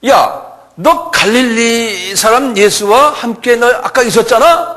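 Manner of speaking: high, loud, drawn-out challenge; accusing call. Acted. Servant accuses Peter of being with Jesus, high accusing call. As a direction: call him out, stretching the words.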